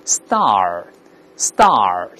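star drawn out slowly, open ah curling into r; ah then curled r. Shown as a demonstration. Teacher demonstrates curling tongue last, slow star with late r. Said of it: "先发啊，最后卷一下舌头".

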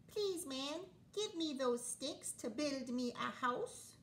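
high polite little pig. Acted. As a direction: ask sweetly like a small pig. Pig asks man politely.

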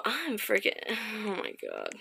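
scared hush. Real, not acted. Scared mumble.

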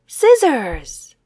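usual speaking voice

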